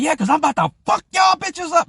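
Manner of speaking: high, loud threat; imitated angry shout. Kato yelling over his shoulder at the women, imitated shout. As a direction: holler it past someone as the furious friend.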